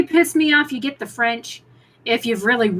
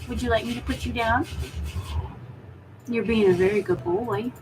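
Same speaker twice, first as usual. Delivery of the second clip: soft pet talk; 2 semitones lower, 7 dB quieter than usual. Real, not acted. Owner gently asks her cat whether to put him down, soft pet talk.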